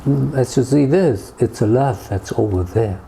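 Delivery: ordinary delivery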